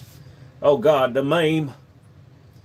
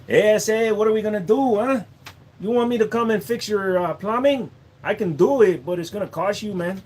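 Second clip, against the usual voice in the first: higher mock Mexican accent; jokey Mexican handyman voice; 7 semitones higher than usual. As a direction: play a cheerful contractor in a put-on Mexican accent.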